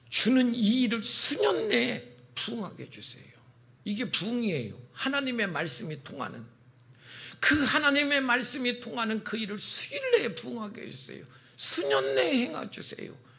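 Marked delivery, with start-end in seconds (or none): 0.1-3.3 high-pitched mock prophet's plea; pleading prayer. 7.4-13.3 high, straining plea to God; desperate pleading prayer. Acted. Acting out the prophet's pleading prayer.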